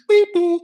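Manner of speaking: high loud beep beep chime; mock beep. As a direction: imitate a shrill announcement beep. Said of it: "What we hear in M M two is".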